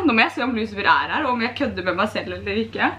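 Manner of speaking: ordinary talk, nothing marked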